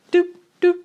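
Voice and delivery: short, high imitated jumps in intonation; high "doop" pitch blips. Shown as a demonstration. English teacher miming jumping intonation, high blips. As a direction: pop out little high doops. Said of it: "intonation would not be steady. It would go".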